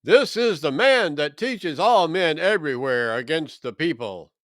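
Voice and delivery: loud, high alarmed outcry for help; crying out. A narrator's reading. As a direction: shout it to the crowd, voice cracking high on help. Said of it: "crying out".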